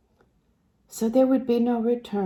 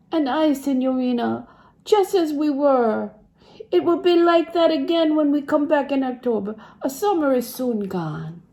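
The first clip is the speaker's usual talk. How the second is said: higher, louder voice for a cheerful Italian man; a touch of an eager Italian accent, raised pitch; hopeful Italian servant; 7 semitones higher, 4 dB louder than usual. Acted. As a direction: reassure her brightly that they'll all be back. Giuseppe consoles Lucy.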